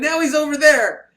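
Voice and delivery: high, loud hammed-up protest; mock alarm. Woman warning the doctor about the man, high loud mock alarm. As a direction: cry it out high as if panicking.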